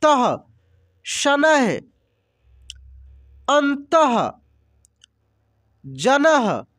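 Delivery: अतः said slowly with a clear breathy "ah" released after the last consonant; teacher drill, stretch the final ः into an audible "aha"; breathy visarga ending. Shown as a demonstration. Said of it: "अह का उच्चारण हो रहा है".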